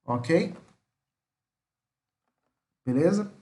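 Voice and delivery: ordinary talk, nothing marked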